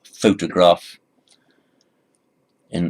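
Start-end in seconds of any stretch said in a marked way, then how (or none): 0.0-1.0 photograph with a loud PHO at the start; PHO-tograph, first syllable strong; stress on first syllable. Shown as a demonstration. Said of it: "stress on the first syllable".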